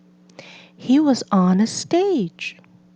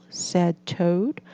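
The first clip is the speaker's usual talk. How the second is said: usual speaking voice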